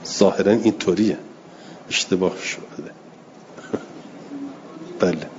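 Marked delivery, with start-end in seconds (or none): none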